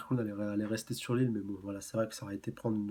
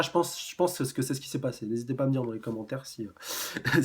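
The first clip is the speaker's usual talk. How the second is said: normal talking voice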